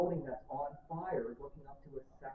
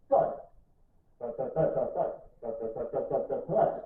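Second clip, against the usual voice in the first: imitated thuds; 4 dB louder than usual. Birds dropping from the sky.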